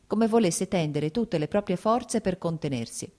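ordinary delivery

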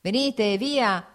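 merry shouted invitation; cheerful peasant's shout. A narrator's reading. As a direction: call them over cheerily. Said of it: "gridò un allegro contadino".

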